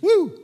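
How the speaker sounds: high, loud whooping cheer; crowd cheer. Acted. Preacher voicing the crowd's cheer, a loud high whoop. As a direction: let out a joyful whoop.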